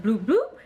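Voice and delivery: little popping bloops, the last flicking up; imitated bloop noise. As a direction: mimic a quick bloop sound. Imitates the test lines popping up.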